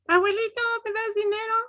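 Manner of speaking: high-pitched request. Shown as a demonstration. Teacher's high-pitched take for grandpa. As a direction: lift it way up high. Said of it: "La voz aguda".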